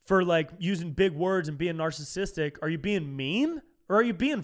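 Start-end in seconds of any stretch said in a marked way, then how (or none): none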